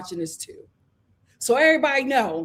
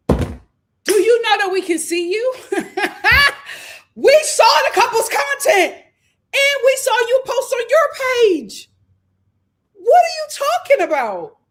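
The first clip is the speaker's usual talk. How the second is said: higher, louder disbelieving shout; incredulous shout; 10 semitones higher, 6 dB louder than usual. Real, not acted. Shouting at Kenny over the couple's content, loud disbelief. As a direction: call him out in disbelief.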